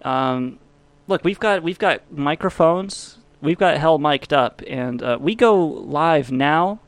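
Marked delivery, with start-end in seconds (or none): none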